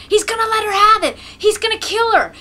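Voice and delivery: high, loud and fast; excited crowd shouts. A narrator's reading. Onlookers shouting as Mokolka raises the shaft, excited. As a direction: yell it out thrilled and horrified. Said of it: "The shouts go up".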